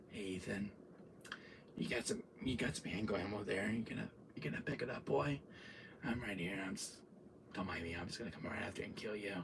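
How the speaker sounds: low, hushed villain murmur; quiet, casual mock menace; taunting. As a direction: toy with your prey very softly. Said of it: "he's talking shit to me".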